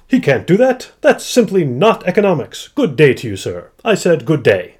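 loud, high indignant British economist; hammed-up upper-class British outrage; terrible British accent. Bad economist dismissing the idea, terrible British accent. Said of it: "my terrible British accent".

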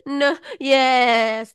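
high, loud, drawn-out imitation; exaggerated high wail of an answer, imitated; mock contradictory child. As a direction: stretch the yes in a comic kid voice.

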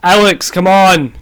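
loud urging. Real, not acted. Hurrying a slow rater.

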